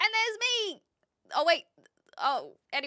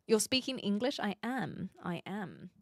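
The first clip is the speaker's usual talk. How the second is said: ordinary delivery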